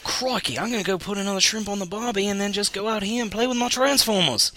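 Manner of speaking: put-on high-pitched Australian accent; crocodile hunter. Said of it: "the crocodile hunter very well".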